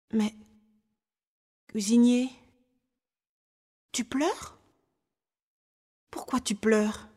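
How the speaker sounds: put-on hesitant child, gently asking; concerned little boy. Boy notices cook is crying. (petit garçon inquiet, question hésitante et douce)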